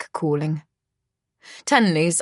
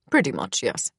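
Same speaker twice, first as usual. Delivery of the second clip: low kingly stepfather; lightly deepened, matter-of-fact voice; Heinrich; 7 semitones lower, 3 dB quieter than usual. A narrator's reading. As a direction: admit it in a deep voice. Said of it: "It's rich and deep".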